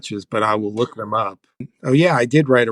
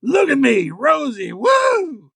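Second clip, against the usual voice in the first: Farley yelling as Rosie; 12 semitones higher, slower than usual. Retold or imitated. Writer acting Farley cartwheeling as Rosie, yelling. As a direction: shout it like a bad Rosie. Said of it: "Chris Farley yelling".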